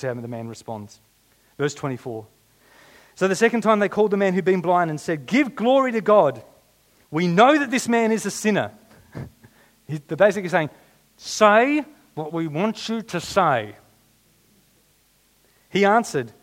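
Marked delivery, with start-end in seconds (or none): 3.1-8.7 loud, high-pitched declamation of a Bible verse; dramatic scripture reading. Acted. Preacher reads the accusers' verse to congregation, dramatic declamation. 11.2-13.8 loud, high, drawn-out command; mock accusers' demand. Preacher voices accusers pressing the healed man, slow loud demand.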